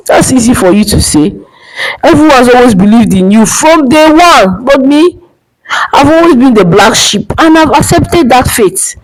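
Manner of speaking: high, bitter scoff at his brother. Acted. Emeka scoffs at his successful brother, bitter and high. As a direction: scoff it out, raising the pitch. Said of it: "Emeka scoffed".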